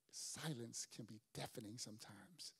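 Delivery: low hushed whisper; hushed whisper of deafening silence. Acted. Preacher whispers the point.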